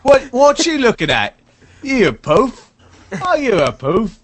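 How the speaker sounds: loud, high, jeering British yob; mock British yob. Co-host as a loud British lout jeering at someone looking. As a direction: jeer like a pub lout. Mocking British lad taunt.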